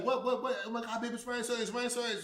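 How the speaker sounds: high, loud and rushed; can't believe who's there; wife hearing the news, excited; excited disbelief. Retold or imitated. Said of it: "And she's like".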